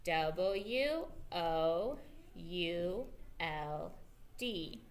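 drawn-out letters for the class; teacher slowly spelling the word for kids. Shown as a demonstration. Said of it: "Let's do it one more time".

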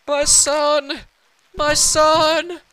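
high, loud wail; mock-emotional father. Dad apologizing to son about presents, high mock-emotional cry.

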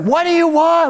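high-pitched exasperated mock holler; stretched, high retold yell; yelling back. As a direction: yell it back like a kid to mom upstairs. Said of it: "she's yelling back and forth".

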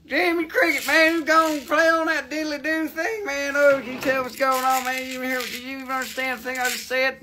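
a country bumpkin voice, lightly put on; hammed-up hillbilly drawl. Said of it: "I got to, you know, talk funny".

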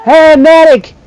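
high, loud call for help; calling for medic. Real, not acted. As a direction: shout across the battlefield. Calls for help after dying.

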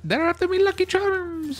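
very high Irish leprechaun squeal; squeaky cereal-mascot brogue; Lucky Charms guy. Acted. Recalling the Lucky Charms mascot for friends, high leprechaun voice. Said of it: "that guy from the Lucky Charms".

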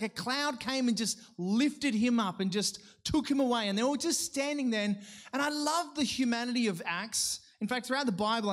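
usual speaking voice

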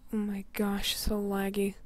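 ordinary talk, nothing marked

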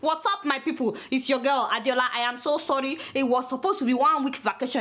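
ordinary talk

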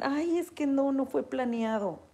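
patient excusing herself to the doctor, mock whiny; whiny excuse. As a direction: whine it like a caught-out patient. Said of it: "Cuando dicen".

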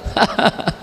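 short breathy mocking laugh; a gunman's scoffing chuckle. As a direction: laugh it off scornfully. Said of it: "हंसने लगा".

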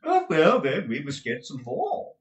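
high, loud, gushing dandy husband; a flamboyant husband fussing over money; Mantalini's voice. Acted. Mantalini asks his wife about the cash, high and gushing.

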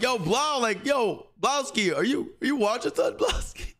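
sing-song teasing from the hallway; roommates calling out. Retold or imitated. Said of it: "So they're like".

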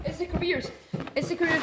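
loud, high panicked cry; alarmed shout. Pretend. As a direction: shout the warning loudly and high. Spotting the careers approach.

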